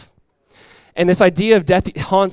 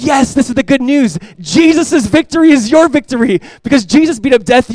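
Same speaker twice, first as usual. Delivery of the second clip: much higher, louder, bursting with good news; excited; 9 semitones higher, 9 dB louder than usual. Retold or imitated. Dad thrilled to tell his son Jesus won, excited and loud.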